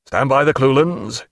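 ordinary delivery, nothing marked

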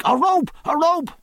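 high, choked cry for help; strangled cry. A narrator's reading. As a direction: call out half-drowning. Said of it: "only a strangled voice from the wake".

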